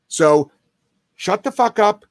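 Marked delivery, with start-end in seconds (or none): 1.1-2.0 high, punched command; sharp command. Pretend. Trainer sums up the correction for a screaming dog, high sharp command. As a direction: cut the dog off hard.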